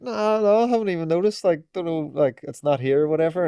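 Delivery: high-pitched play-acted innocence; feigned ignorance. Skater to shop worker, feigning ignorance.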